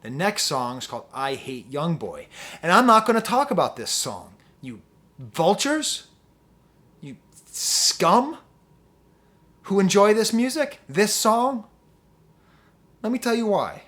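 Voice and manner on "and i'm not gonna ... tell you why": louder and higher, scolding the listeners; angry rant. Real, not acted. Ranting at fans who enjoy the song, loud and scolding. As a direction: lash out at the fans.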